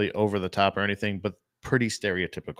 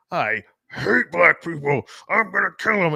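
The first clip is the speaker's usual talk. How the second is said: higher, exaggerated angry character voice; mock hateful rant; 6 semitones higher than usual. Mocking a film's plot to co-host, mock hateful rant. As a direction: act out a cartoonish raging villain.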